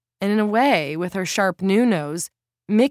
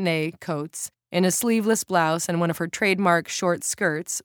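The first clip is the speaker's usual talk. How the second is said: usual speaking voice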